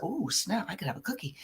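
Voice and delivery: softly mock-sneaky, almost under breath; sneaky hush. Retelling sneaking a cookie at work, hushed to herself.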